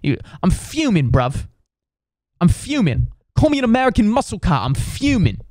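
high-pitched British grumble, punching the key word; indignant British bloke complaining to his mate; hammed-up British outrage; fuming British dude. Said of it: "He just kept saying".

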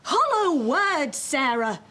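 shouting. Acted. Mother scolding daughter over her boyfriend, shouting. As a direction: lecture her at rising pitch and force. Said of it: "stop shouting at me".

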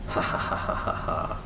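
soft, breathy ha-ha-ha; woodpecker's voiceless chuckle; acted laugh. A narrator's reading. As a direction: laugh lightly in character, mostly air. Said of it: "are you laughing".

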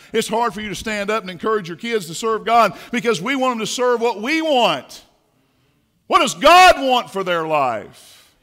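drawn-out loud pulpit plea; slow, loud preaching. Real, not acted. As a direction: stretch every word to the back row. Sermon climax.

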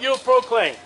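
ordinary talk

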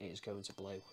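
usual speaking voice

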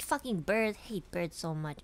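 usual speaking voice

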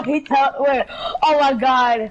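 ordinary delivery

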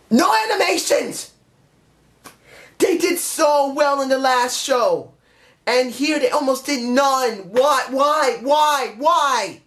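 high-pitched ranting yell; worked-up rant, voice pitched well up; exasperated outburst. Pretend. Prepared outburst about missing animations.

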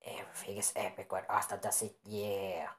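whispery put-on voice; hushed character voice without full tone; different put-on voice. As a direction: say it in an odd, whispered voice. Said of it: "Now it's a different voice than I did before".

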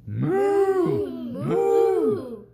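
high loud mooing cow. Pretend. Parent reading to a child, a loud high cow moo. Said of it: "He can sound like a cow".

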